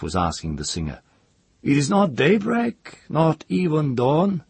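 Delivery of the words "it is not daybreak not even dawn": chief's voice. A narrator's reading. Chief presses the singer.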